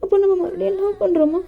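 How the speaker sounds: high, pleading voice with crying breaks; tearful young lover. Acted. JK pleads with Dev not to leave, high and tearful. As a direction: beg him not to leave you, close to sobbing.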